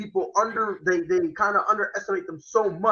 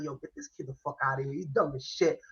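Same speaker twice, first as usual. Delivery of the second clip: imitating the coach; 8 semitones lower, 8 dB quieter than usual. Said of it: "He's like".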